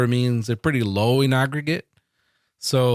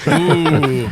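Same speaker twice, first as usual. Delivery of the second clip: mock ooh; 7 dB louder than usual. Real, not acted. Mock awe at blockchain idea.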